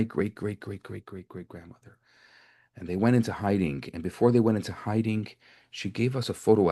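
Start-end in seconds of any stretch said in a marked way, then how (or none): 0.0-2.0 starts at usual volume, dwindles to barely audible; fading away. Pretend. Stranger linking two families' ancestors, fading away. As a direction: let the repeated "great" trail far off. Voicing generations receding into the past.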